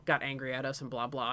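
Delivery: ordinary delivery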